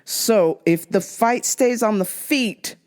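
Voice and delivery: ordinary talk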